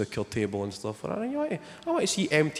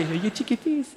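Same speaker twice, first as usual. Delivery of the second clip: high coo, falling on face; cooing at the newborn, high and sweet; cooing baby talk; 10 semitones higher than usual. Retold or imitated. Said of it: "they can just go".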